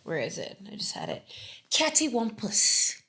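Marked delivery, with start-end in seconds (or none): 2.0-3.0 slightly British, raised pitch; British accent. Real, not acted. Host revealing her favourite word, in a British accent. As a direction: say it with a British lilt. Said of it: "saying it with a British accent".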